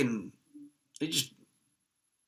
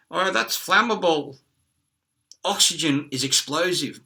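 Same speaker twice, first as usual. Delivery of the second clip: slow, slightly raised dismissive doctor; mock doctor; 4 semitones higher, 4 dB louder than usual. Mocking the doctor who rejects ether, slow and dismissive. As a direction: drawl out the doctor's excuse.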